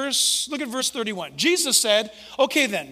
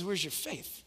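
much softer, low gentle reproach; quiet rebuke; 3 semitones lower, 10 dB quieter than usual. Acted. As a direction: ask it softly, almost disappointed. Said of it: "He says".

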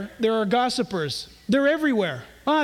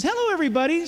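higher, cheery little alien; little green Martian; 6 semitones higher, much faster than usual. Acted. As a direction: greet everyone like a tiny cute Martian. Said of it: "said the little green Martian".

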